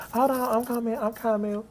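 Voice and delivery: imitated star's reply. Singer tells screaming fans she's on her way, imitated soft reply.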